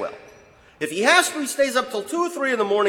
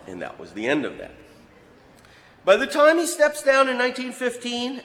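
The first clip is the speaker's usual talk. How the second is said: ordinary delivery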